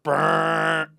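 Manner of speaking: loud, drawn-out buzzing noise; imitated light buzz. Mimicking her overhead light, loud held buzz. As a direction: imitate a harsh light switching on. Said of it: "turns on the overhead light".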